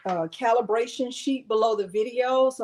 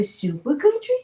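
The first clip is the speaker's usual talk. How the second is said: squeaky coax; 11 semitones higher than usual. Acted. Coaxing dog with treat.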